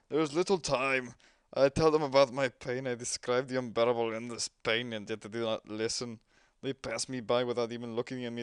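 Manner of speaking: tormented patient begging for release; suffering patient. Acted. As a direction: beg the visitor to end his pain.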